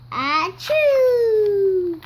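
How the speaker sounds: high, loud exploding achoo; big hammed-up sneeze. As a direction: sneeze it out full force.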